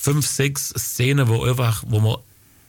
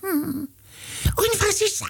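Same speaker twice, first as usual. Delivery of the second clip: Äffle cartoon monkey; 5 dB quieter than usual. Äffle asking for the very worst, high monkey voice. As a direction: build to the climax in squeaky falsetto. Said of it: "Der geht jetzt folgendermaßen".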